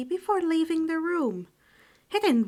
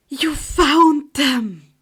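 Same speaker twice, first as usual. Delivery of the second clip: slow, loud amazed exclamation; gasp then delighted cry; 4 semitones higher, 12 dB louder, much slower than usual. Acted. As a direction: gasp of joy. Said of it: "the teen exclaimed".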